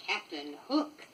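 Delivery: ordinary delivery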